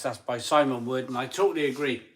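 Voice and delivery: ordinary delivery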